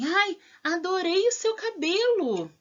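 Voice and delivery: high-pitched admiring exclamation. Shown as a demonstration. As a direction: exclaim it as a delighted compliment, pitch lifted. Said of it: "A frase exclamativa".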